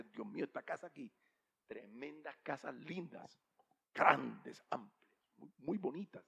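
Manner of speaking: softly marvelling; hushed admiration. Acted. Passers-by admiring huge houses, hushed admiration.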